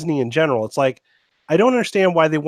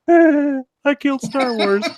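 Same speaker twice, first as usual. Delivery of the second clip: slow, higher impish troll voice, hammed up; mock the director as a little leprechaun gloating; elfin troll; 9 semitones higher, much slower than usual. Podcaster mocks director as gloating elfin troll. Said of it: "like this elfin type".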